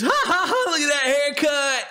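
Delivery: high, jeering fake laugh into taunt; hammed-up mockery; mocking laughter. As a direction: make fun of them in a high, silly voice. Acting out mockers of a haircut.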